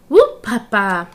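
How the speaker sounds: polka whoop. Acted. Polka cry.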